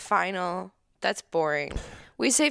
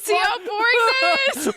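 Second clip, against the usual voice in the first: higher and louder, mock exasperated; teasing complaint; 6 dB louder than usual. Real, not acted. Wife teasing husband about the boring game, mock exasperated. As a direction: needle him about how dull it is.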